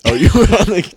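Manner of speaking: high loud sudden realization; surprised. Real, not acted. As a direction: caught out mid-sentence. Surprised correction.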